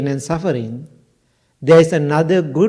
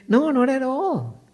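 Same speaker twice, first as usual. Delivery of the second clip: high, lilting, smiling "no"; smiling reassurance; 10 semitones higher than usual. Retold or imitated. Monk answering a woman's apology, smiling and reassuring. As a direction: wave the worry away with a high, light lilt. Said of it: "Then I smiled and I said".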